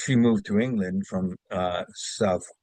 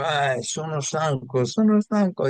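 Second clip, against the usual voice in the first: Italian accent, rising higher on the repeat; Italian fishmonger; 5 semitones higher than usual. Shown as a demonstration. Actor recalls his Italian fish-stand role, Italian accent. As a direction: sigh it out like a weary Italian fishmonger. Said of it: "I played an Italian fishmonger".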